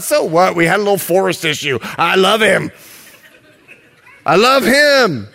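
breezy shrug of a reply, laughing; lightly voiced carefree bride; dismissive bride. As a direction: brush the problem off giddily. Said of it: "I just love you".

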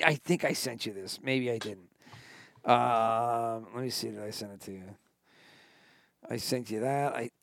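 low, soft, distracted murmur; talking to himself. Real, not acted. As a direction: trail off quietly while searching for something. Searching phone, talking to himself.

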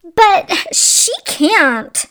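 high, loud, drawn-out storybook shriek; furious shriek. A narrator's reading. Stepmother objects to the prince's proposal, shrieking furiously. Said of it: "shrieked her furious stepmother".

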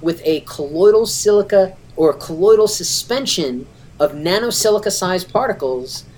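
ordinary delivery

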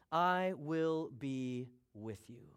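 drawn-out "I will be with you", word by word; slow, weighty promise. Acted. Preacher reading God's promise to Moses, slow and weighty. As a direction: give every word weight, very slowly.

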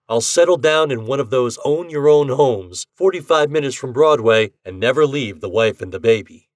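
a hint of a cocky bettor; Ashton voice. A narrator's reading. Ashton dreaming.

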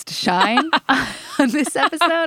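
words broken by a real laugh; giggling while finishing the sentence; laughing through it. Real, not acted. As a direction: laugh your way to the end of the line. Host laughs mid-sentence.

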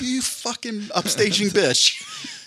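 slow, high, venomous insult; drawn-out catty hiss of spite; bitter high sneer. Retold or imitated. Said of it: "It's like".

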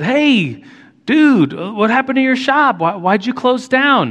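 casual surprised greeting, pitched well up; a bright, high-voiced hey dude, lightly acted; friendly surprise at a closed shop. Retelling asking a friend.

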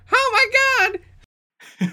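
high, loud cry of shock. Acted. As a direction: yelp it out in shock. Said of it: "and he goes".

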